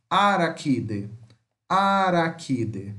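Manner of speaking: araquide with a hard K, drawn out; model pronunciation. Shown as a demonstration. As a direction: say araquide slowly, K sound clear. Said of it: "fica com som de K ou Q".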